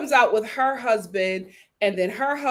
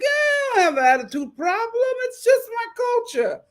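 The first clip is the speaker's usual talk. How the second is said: mocking Florian; 11 semitones higher than usual. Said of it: "And he was like".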